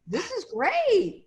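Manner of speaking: thrilled actor. Retold or imitated. Retelling the new actor's joy at his first job, mock excited. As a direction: pitch it up high and draw it out with delight. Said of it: "The guy was like".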